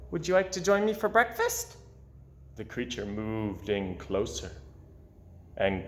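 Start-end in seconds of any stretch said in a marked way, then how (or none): 0.1-1.8 1 friendly fish voice. Acted. Gilbert invites the cat.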